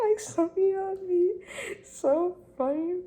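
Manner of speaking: laughing high and loud through the words. Real, not acted. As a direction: crack up at how funny they are. Laughing at viewers' questions.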